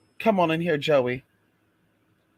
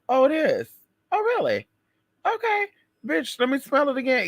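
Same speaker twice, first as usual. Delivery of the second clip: high squealing fake surprise; mock surprise; 10 semitones higher than usual. To the server, high mock disbelief. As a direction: act shocked and suspicious at the lamb.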